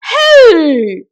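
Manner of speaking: sudden loud alarmed shout; alarmed cry. A narrator's reading. Miggle shouting at the flying jug in alarm. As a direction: yell it out in alarm. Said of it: "cried Miggle in alarm".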